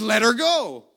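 loud, high commanding yell; shouted order. Retold or imitated. Healer insisting the men release her, shouting. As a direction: fire it out as an order.

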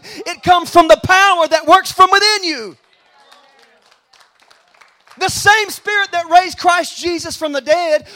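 loud, high-pitched, driving pulpit proclamation; full-force preaching. Real, not acted. Preacher declaring to the congregation the power within them, full-force preaching. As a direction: drive each phrase home at full volume.

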